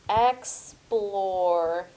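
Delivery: "Explore" stretched into two parts; syllable by syllable. Shown as a demonstration. Teacher thinking aloud over spelling, "Explore" sounded in syllables. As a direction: say "Explore" slowly as ex, plore. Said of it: "Let me think".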